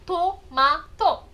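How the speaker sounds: "Tomato" drawn out slowly, to-ma-to, each syllable even; slow Japanese word. Shown as a demonstration. Teacher showing a katakana word to students, very slowly. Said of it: "escrito em katakana".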